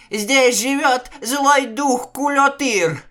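high, loud, slow squeal of terror; shrieking. A narrator's reading. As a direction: shriek it in panic. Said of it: "взвизгнул Акыс".

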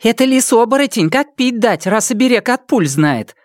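high, bright gossiping worker; a village woman, sure of herself; female worker voice. A narrator's reading. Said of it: "заключила одна из работниц".

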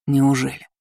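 cold, through teeth. A narrator's reading. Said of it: "процедил капитан Линдгейт".

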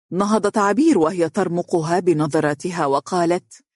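plain reading voice

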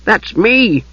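high, eager little Kender voice; high-voiced, obliging interjection; helpful Kender. A narrator's reading. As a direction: chip in helpfully, pitched right up. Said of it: "the Kender said helpfully".

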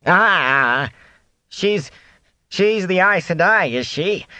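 high, halting peddler voice; Fane, wary. Acted. Fane learns who travels with Rand, wary. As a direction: stammer into a pointed, high question.

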